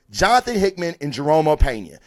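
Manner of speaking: normal talking voice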